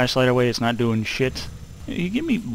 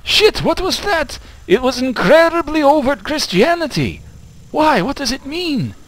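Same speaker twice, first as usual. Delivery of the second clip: high loud startled yelp; 13 semitones higher, 5 dB louder than usual. Real, not acted. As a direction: jump at a sudden fright. Startled by something in game.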